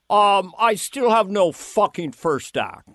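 slow, drawn-out boss impression; mock weary producer complaint; Lorne. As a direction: imitate a frustrated show boss, taking it slowly. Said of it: "This was the first hook I, how I got Lorne".

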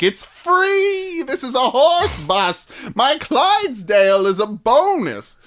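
shouting it out high and slow, thrilled; like a cheery street seller offering free rides; excited hawker. Retold or imitated. Playing the horse busman calling out.

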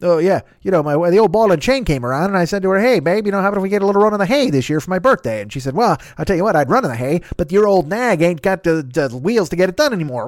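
put-on hammy old ball-and-chain joke delivery; old hack comedian. Hack comic telling a wife joke, corny old comedian. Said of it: "old fucking bad comedian, fucking Jack Carter nonsense".

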